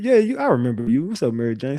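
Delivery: ordinary talk, nothing marked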